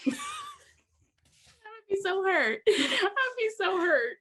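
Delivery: high, drawn-out words through laughter; laughing. Real, not acted. Imagining being told off for her accent, laughing. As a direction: laugh helplessly while talking.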